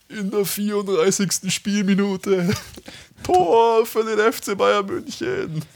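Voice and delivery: echoing stadium announcer. Pretend. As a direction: belt out the goal like the stadium speaker. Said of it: "Das macht irgendwie Spaß".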